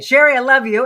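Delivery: loud, high burst of affection; excited shout-out. Real, not acted. Trainer calling out love to her hairdresser, excited. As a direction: gush it out to a friend.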